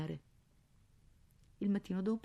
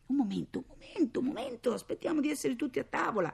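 high-pitched, repeated fending-off; flustered father; 10 semitones higher than usual. A narrator's reading. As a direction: hold off children pestering with questions. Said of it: "lui si difendeva dicendo". (padre assediato, voce acuta)

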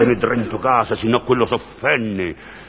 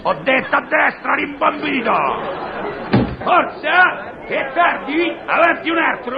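yelling. Acted. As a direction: bark the next patient in. Impatient professor yelling at patients. (urlando spazientito)

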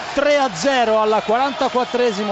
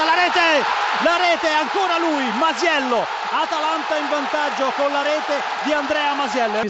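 high shouted goal call; 4 semitones higher, slower than usual. Real, not acted. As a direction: yell the goal out. Goal call in live commentary. (urlo da gol)

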